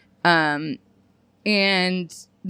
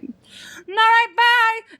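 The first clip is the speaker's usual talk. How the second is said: much higher, airy mock voice for a quick exit; mock high bye; 13 semitones higher, much slower than usual. To a hookup, imitating her own awkward high goodbye. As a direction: toss it off in a high put-on voice. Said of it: "I'm like".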